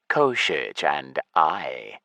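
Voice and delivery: Koshich said low and slow, falling; lich voice. Acted. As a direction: drag out Koshich, deep and grave. Lich names his ally.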